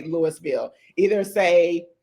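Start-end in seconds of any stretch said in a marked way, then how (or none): 0.0-0.7 Louisville said LOO-is-vil with the s; outsider's Louisville with a hard s; wrong pronunciation. Shown as a demonstration. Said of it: "don't say Louisville".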